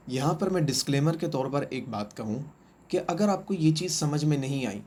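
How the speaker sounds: ordinary talk, nothing marked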